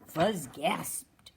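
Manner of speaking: plain reading voice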